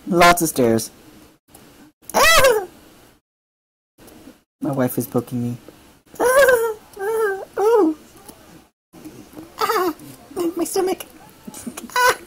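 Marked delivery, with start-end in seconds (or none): 2.1-2.7 high yelp while being tickled; squealing from a tickle. Real, not acted. She's tickling me. 6.2-12.2 high squeals while being tickled. Real, not acted. She's tickling me.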